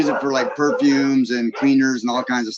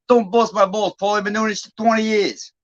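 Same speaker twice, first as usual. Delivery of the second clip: high-pitched Long Island tough guy; imitated Long Island working man, indignant; Long Island accent; 8 semitones higher than usual. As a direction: brush him off in a put-on Long Island accent. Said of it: "I can do, like, Long Island".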